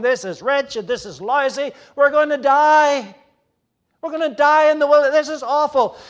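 loud, high panicked whining about dying in the desert; complaining Israelites. Retold or imitated. Preacher voicing Israelites complaining to Moses, loud panicked whine. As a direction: wail it like doomed grumblers in the wilderness. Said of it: "they complained about all that".

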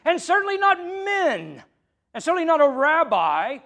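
not punched high and loud in both lines; stress on not. Real, not acted. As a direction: hit the word not hard, pitch jumping up. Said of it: "to emphasize".

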